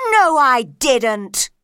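slow, very loud denial. A narrator's reading. Knave denying the charge, slow and very loud. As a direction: shout it down, drawn out. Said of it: "said the knave loudly".

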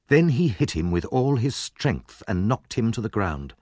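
usual speaking voice

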